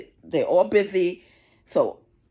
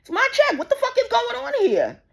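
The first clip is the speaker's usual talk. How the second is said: higher, shouted, fast angry rant; replaying her own outraged yell; retold outburst; 9 semitones higher than usual. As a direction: blow up at the bank staff. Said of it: "I'm like".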